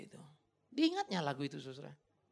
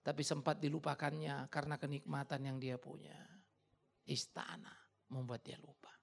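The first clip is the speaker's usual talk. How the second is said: ordinary talk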